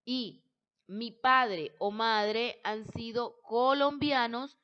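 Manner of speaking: ordinary delivery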